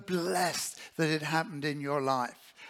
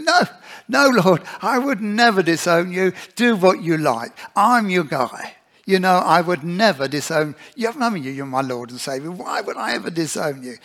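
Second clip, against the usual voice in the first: Peter protesting; 3 semitones higher, 12 dB louder than usual. Acted. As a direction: lightly voice Peter insisting he is loyal.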